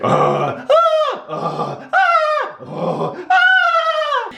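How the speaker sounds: high squealing mock screams, jumping up and down; hammed-up panicked screams, half playful; mock screaming. Imitating the couple screaming as the nose water sprays her face. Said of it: "she's screaming and I'm screaming".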